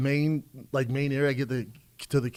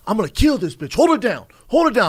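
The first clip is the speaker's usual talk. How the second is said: loud, high mock shout building; yelled threat; 8 semitones higher, 8 dB louder than usual. Comedian imitates a pimp yelling threats next door, building mock shout. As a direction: yell it like a thug losing it.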